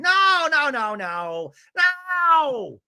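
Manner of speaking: high, loud, repeated mock yelling; default yelling. [name] tries to cut Jen off, high mock yelling. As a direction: yell it out, imitating a panicked objector. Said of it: "doing her default yelling".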